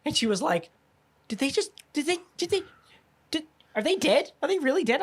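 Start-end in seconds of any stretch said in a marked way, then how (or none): 1.3-5.0 high, stammering disbelief; mock shock. Imitating his wife stunned that everyone died. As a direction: stumble over it like you can't believe it.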